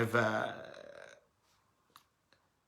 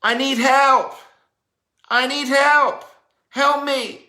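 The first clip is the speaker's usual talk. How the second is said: much higher, much louder pleading cry; desperate plea; 11 semitones higher, 10 dB louder, slower than usual. Pretend. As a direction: call out for help as if drowning. Voice of a woman in need.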